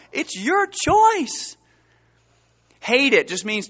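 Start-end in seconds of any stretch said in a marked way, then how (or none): 0.1-1.6 high and drawn-out; high, slow pointed aside. Acted. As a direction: stretch it out high like a challenge.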